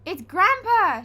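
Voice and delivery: high loud shout. A narrator's reading. Peter shouting to the family, loud and high. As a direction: call the alarm. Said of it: "shouted Perfect Peter".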